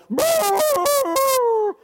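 high, sustained mock dog bay; man imitating hound howl. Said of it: "somebody acting like a dog barking".

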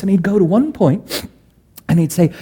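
usual speaking voice

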